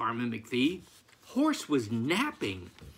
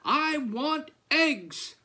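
demanding cry; 13 semitones higher, 4 dB louder than usual. A narrator's reading. Farmer waking the chickens, high demanding cry. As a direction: rouse them with a high, insistent yell. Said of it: "Farmer McPhee cried".

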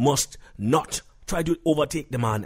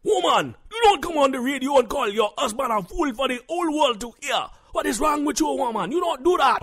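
high, loud scolding shout; indignant husband; 11 semitones higher, 3 dB louder than usual. Acted. Husband scolds wife for calling him a fool, angry shout. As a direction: berate her in an outraged, high-pitched voice.

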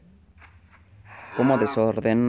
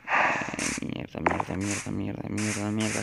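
muttered swearing, softer and lower; cursing under breath; 3 semitones lower, 6 dB quieter, slower than usual. Real, not acted. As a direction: swear quietly to yourself, over and over. Repeated swearing while rushing.

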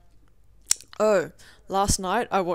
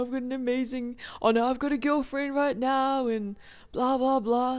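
bragging ex; 5 semitones higher than usual. Retold or imitated. Quoting the ex on his girlfriend, lightly mocking. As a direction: lightly parody his boasting, trailing into dismissal.

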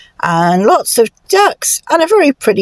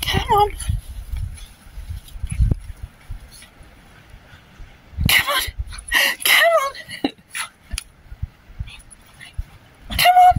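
high-pitched call to a dog; 9 dB quieter, faster than usual. Real, not acted. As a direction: coax the dog along in a high voice. Calling dogs along on walk.

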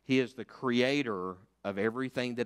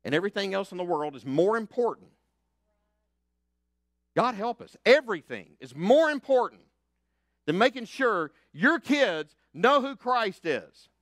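loud, high, fervent preaching; preaching at full force; 9 semitones higher, 8 dB louder than usual. Acted. Pastor rallying his church, preaching loud and high. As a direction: lift them up with the message.

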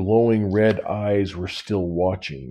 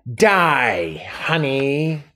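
1 snarled threat; 5 semitones higher, much slower than usual. A narrator's reading. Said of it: "She snarled".

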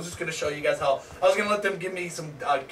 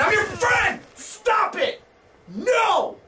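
very high, frantic shriek; desperate high-pitched pleading; panicked screaming; much slower than usual. Real, not acted. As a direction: cry out as you're being attacked. Plea turns to panicked screaming.

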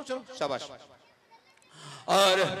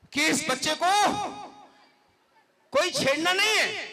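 raised, high stern warning to the crowd; 7 semitones higher, 5 dB louder than usual. Real, not acted. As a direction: warn them loudly and firmly. Host warning the audience. (ऊँची सख़्त चेतावनी)

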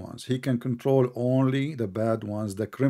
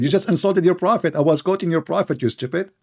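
loud, raised-pitch rebuke; indignant accusation; 4 semitones higher, 5 dB louder than usual. Real, not acted. Debater turns the blame on his opponent, indignant. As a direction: throw the accusation back forcefully.

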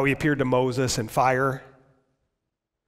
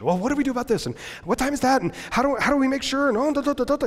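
high fretting voice; worrywart; 12 semitones higher, 4 dB louder, faster than usual. Retold or imitated. Melanchthon fretting to Luther, high anxious voice. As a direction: fret like a nervous worrier. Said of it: "the worrywart of the whole Reformation".

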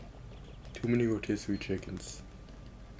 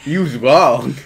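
booming laugh and taunt; very loud triumphant jeer; gloating villain; 4 semitones higher, 16 dB louder, slower than usual. Acted. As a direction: laugh loudly, then crow it. Villain gloats in acted game text.

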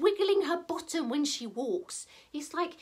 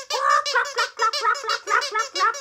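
loud, high, rapid-fire clucks; hen clucking; 8 semitones higher, 5 dB louder than usual. Pretend. Storyteller and children flap round as hens, clucking loudly. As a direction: squawk out clucks like a busy hen. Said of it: "what noise do we make?".

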